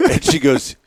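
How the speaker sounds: ordinary talk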